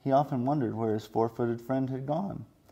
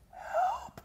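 feeble groan; 4 dB quieter than usual. Acted. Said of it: "he groaned feebly".

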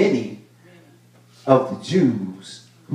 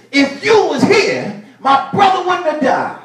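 high, lightly pleading Mary; voicing grieving Mary. Preacher voicing Mary to Jesus, high and pleading. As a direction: lift the voice into Mary's lament.

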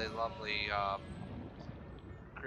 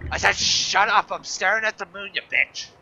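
louder, higher slowed-down shout; mock angry yell; 6 semitones higher, 11 dB louder than usual. Gamer yelling at the dehydration alarm, mock furious shout. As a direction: yell at the nagging warning.

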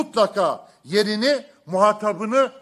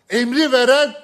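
loud emphatic; 4 semitones higher, 7 dB louder than usual. Real, not acted. Rhetorical climax, loud burst.

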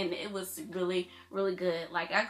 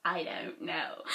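whisper; 3 semitones lower, 4 dB quieter, slower than usual. Real, not acted. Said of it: "why am I talking like this?".

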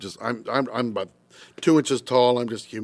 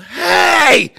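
sudden yell at the top of the lungs, much higher and louder; yelled hey; 9 dB louder than usual. Retold or imitated. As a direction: stop the whole orchestra with one yell. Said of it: "I yelled".

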